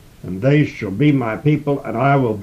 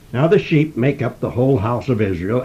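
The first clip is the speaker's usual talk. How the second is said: ordinary talk, nothing marked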